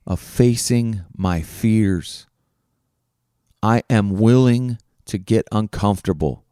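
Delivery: slow, deliberate affirmation; measured self-affirming recitation with pauses; spoken affirmation. Real, not acted. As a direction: declare it slowly, word by word.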